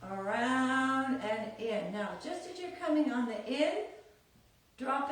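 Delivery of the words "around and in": very slow, drawn-out round and in; slow count. Shown as a demonstration. Teacher demonstrates the slow hip move, very slow. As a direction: stretch it out to show the timing. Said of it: "take your time".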